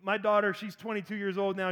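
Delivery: normal talking voice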